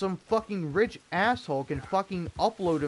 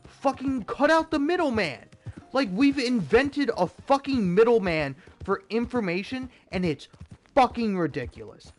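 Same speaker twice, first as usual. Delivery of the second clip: loud, high furious rant; angry shout; 8 semitones higher, 6 dB louder than usual. Real, not acted. Streamer ranting at viewers about AI, shouting furiously. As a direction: yell it out, fed up.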